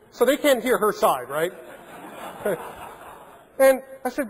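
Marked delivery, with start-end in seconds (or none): 1.6-3.1 quiet laugh. Real, not acted. Laughing at own prank story.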